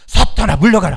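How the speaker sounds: loud, forceful shout; commanding rebuke. Shown as a demonstration. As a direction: shout down the devil. Preacher models rebuke for congregation. (사탄을 쫓는 힘찬 외침)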